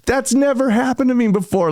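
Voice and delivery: high, amazed retelling; stunned disbelief. Retold or imitated. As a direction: sound floored by what just happened. Stunned reaction to heckler.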